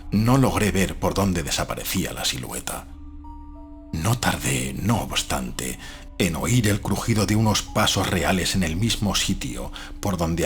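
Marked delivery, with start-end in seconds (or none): none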